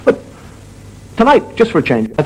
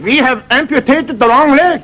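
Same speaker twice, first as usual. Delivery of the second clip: high comic German surgeon; German accent; 12 semitones higher, slower than usual. Acted. German surgeon waking the patient with news, comic accent. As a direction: announce it stiffly in mock German. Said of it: "He said".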